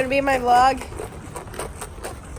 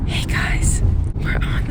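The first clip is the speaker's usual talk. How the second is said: whispering; slower than usual. Real, not acted. Whispered vlog intro.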